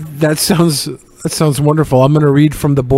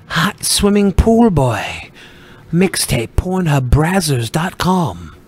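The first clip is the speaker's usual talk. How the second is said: announcer voice; much slower than usual. Pretend. Silly announcer bit.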